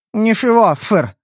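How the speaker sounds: mumbling with a full mouth; talking through a mouthful. Acted. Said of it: "пробормотала та с набитым ртом".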